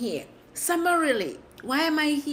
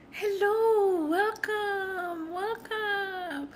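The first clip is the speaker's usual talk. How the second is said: half-screamed hello that trails off; mock screamed greeting; 6 semitones higher, much slower than usual. Streamer repeats her scream greeting for viewers, high and strained. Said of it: "That is my scream".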